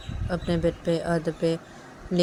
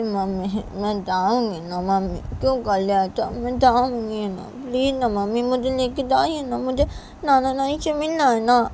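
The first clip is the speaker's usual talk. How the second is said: higher, whiny begging; pleading child; 6 semitones higher than usual. Acted. Daughter begs mother to take her to grandparents, whiny plea. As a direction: wheedle like an upset little daughter.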